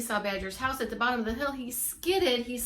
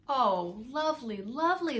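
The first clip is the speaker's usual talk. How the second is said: high, happy little mice voices; squeaking mice; 4 semitones higher, 4 dB louder than usual. Acted. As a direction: squeak it out like tiny grateful mice. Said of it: "they squeaked".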